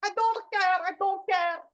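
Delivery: slow, dismissive brushoff, a touch put on; dismissive mock brushoff. The woman walking away from the speaker, mock dismissive. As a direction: wave her off with a repeated I don't care. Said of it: "so she's like".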